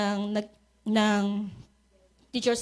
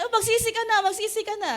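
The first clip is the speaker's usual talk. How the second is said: high, lightly scolding repent; mock scolding; 8 semitones higher, faster than usual. Preacher mimics John the Baptist scolding people, light mock scolding. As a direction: nag them to repent in a raised, mock-stern voice. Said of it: "galit si John the Baptist".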